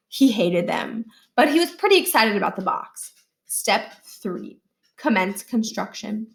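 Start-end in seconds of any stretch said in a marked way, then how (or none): none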